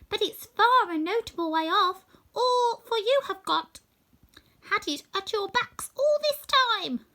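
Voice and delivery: a tiny queen's gracious reply; squeaky mouse queen. Acted. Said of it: "her squeaky little voice".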